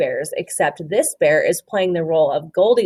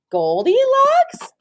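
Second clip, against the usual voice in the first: high, slow drawn-out "Goldilocks?"; surprised question; 4 dB louder, much slower than usual. A narrator's reading. The bear recognising Goldilocks, surprised and high. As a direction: gasp out her name in recognition. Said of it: "said the bear".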